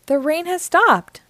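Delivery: high, happy announcement; excited cry. A narrator's reading. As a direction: cry out good news like a child. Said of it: "cried Peppa".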